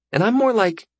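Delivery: put-on high-pitched girl explaining awkwardly; high, awkward teenage explanation; self-conscious girl. Voices Gilly's message.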